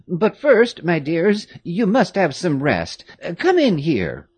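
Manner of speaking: put-on a doting old servant, amazed; old woman greeting the girls; old nurse. A narrator's reading. Old nurse Louise voiced.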